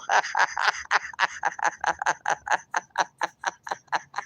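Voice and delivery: long soft laugh running out of air; real helpless laugh. Real, not acted. Host losing it at the pinky toe joke, helpless laughter. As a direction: laugh until breathless.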